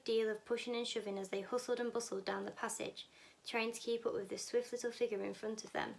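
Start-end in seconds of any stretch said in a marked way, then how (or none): none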